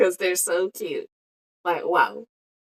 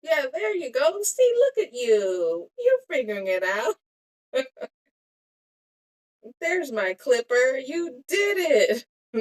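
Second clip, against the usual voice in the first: put-on praising herself as if to a child; mock praise; 7 semitones higher than usual. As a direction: cheer yourself on like a proud parent.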